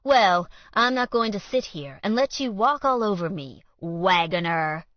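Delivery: loud sneer landing hard on Wagoner; sneering emphasis on name. Acted. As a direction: lean on Wagoner with contempt. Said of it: "He emphasized Colt's last name with a sneer".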